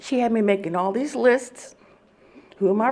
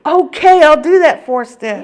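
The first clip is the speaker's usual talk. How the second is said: exasperated giving in; 8 semitones higher, 12 dB louder than usual. Retold or imitated. Giving in to the nagging sponsor.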